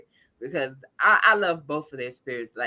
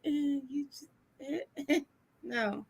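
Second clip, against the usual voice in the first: softer, hesitant refusal; soft protective no; 11 semitones higher, 11 dB quieter than usual. Retold or imitated. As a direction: gently wave her off. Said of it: "I'm just like".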